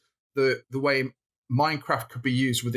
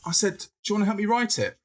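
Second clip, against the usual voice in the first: asking wife; 8 semitones higher than usual. Retold or imitated.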